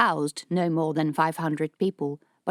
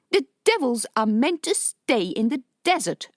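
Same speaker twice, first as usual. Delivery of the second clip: indignant; 7 semitones higher, 3 dB louder than usual. Acted. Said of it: "her voice indignant".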